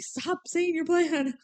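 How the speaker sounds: high, slow mock scolding; lightly voiced, fed-up villain. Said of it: "Yeah, she was like".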